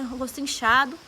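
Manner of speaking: usual speaking voice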